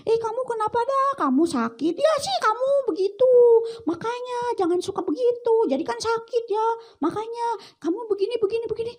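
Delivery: mock scolding, pitched well up; mocking a sick friend. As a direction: needle the sick kid in a high voice.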